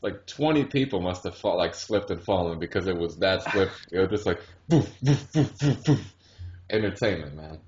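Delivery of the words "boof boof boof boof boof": higher, louder boof noises; mock falling thuds. Telling a friend about drunk clubgoers falling, cartoon thud noises. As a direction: punch out each thud like a body landing. Mimicking people falling on ice.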